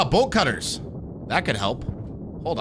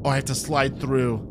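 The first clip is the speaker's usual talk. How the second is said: normal talking voice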